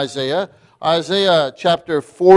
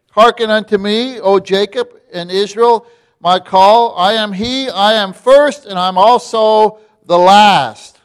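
higher and louder, slowing on "I am the first"; proclaimed scripture; 4 semitones higher, 4 dB louder than usual. Acted. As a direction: thunder out God's words from the pulpit. Reads scripture aloud.

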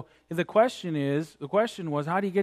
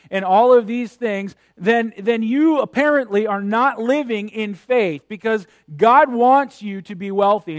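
louder and higher, driving the sermon home; preaching at full force; 5 semitones higher, 8 dB louder than usual. Acted. Preacher warning his congregation against a prosperity message, fired up and loud. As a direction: build it up and hammer every line.

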